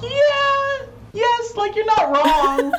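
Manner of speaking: squealing it out high through laughter; high squeaky giggle-talk; laughing squeal. Real, not acted. Laughing agreement about deadly Australia.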